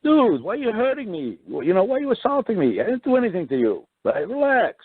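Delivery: high, pleading protest; mock outraged victim; retold protest to the rough cop. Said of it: "I'm saying".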